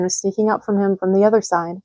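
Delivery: plain reading voice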